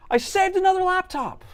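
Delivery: very high, loud victory cry; excited. Real, not acted. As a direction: crow with delight. Triumph after the fix.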